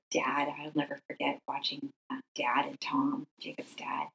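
barely voiced, fading to a breath; choked-up whisper. Real, not acted. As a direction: whisper it as grief takes your voice. Remembering the tiny casket carried.

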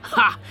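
one sharp barking laugh. Acted. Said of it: "Sverrir let out a barking laugh".

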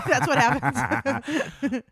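slow words through laughter; laughing. Real, not acted.

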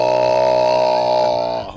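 deep, loud, drawn-out "Oh!"; Booker T impression. Mocking a wrestling commentator's loud low exclamation. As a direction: boom it out low like a ringside commentator. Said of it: "he just talks like this".

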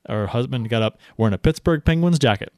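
ordinary delivery

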